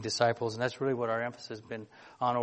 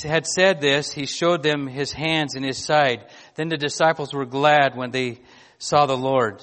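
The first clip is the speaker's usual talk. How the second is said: louder, measured reading of the Bible passage; scripture reading; 6 dB louder than usual. Acted. Pastor reading scripture to his church, louder and solemn. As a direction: read the Gospel out to the congregation with weight. Preacher reads John passage aloud.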